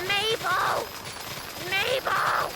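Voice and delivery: high calling voice from across a lake; high, drawn-out mock call of a woman; distant calling. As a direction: call out for a lost kid. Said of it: "we hear from across the lake".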